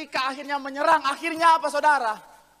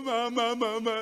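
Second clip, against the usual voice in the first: lightly voiced little child calling mama; small child; 3 dB quieter, slower than usual. Child calls mother on coming home from school, small child voice. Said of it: "anak kecil dia pulang".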